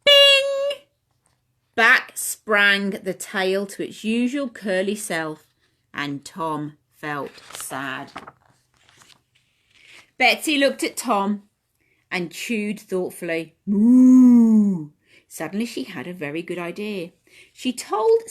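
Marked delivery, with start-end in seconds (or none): 0.0-0.8 high, louder springy bing; playful spring noise; boing sound. Acted. Tail springs back. 13.7-14.9 long, louder moo; drawn-out cow moo. Acted. Betsy the cow.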